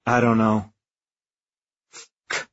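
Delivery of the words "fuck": breathless whispered swear; whispered curse. Acted. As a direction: breathe it out in despair. Despair behind jammed door.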